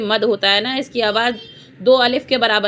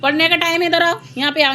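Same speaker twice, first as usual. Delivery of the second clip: calling out; 6 semitones higher, faster than usual. Real, not acted. Teacher calling children over for reading time, raised calling voice. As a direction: call them over to sit down, pitched up.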